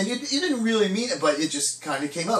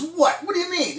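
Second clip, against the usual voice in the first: high-pitched puzzled questioning; baffled; 10 semitones higher than usual. Acted. Puzzled fisherman questions his buddy about the mark, baffled.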